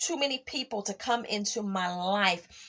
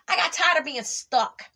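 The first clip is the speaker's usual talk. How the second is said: louder, higher and racing fast; fired up; 7 semitones higher, 5 dB louder, faster than usual. Real, not acted. To her podcast listeners, talking herself into an excited rush. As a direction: preach it with rising fire. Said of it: "I don't even know why I'm talking like this".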